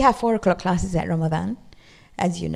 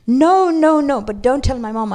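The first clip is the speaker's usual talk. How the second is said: put-on child pleading; 3 semitones higher, 4 dB louder than usual. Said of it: "little girl pleads".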